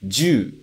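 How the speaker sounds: "ju" as one sound, ju-u; teacher models "ju" in one syllable; contracted juu. Shown as a demonstration. Teacher showing pistol word: "ju" contracted. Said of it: "E a segunda palavra".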